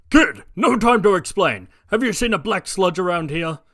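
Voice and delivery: loud, hurried hero; a hero in a rush; All Might voice. Acted. All Might bursting out of the sewer asking the kid, loud and hurried.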